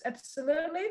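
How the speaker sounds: ordinary talk, nothing marked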